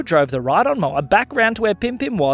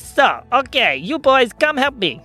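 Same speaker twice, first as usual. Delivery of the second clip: higher-pitched, carefree character voice; cheerful schemer; 7 semitones higher than usual. Acted. Pim Pim dismisses the boys' doubts, carefree. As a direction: brush off doubts and rally helpers.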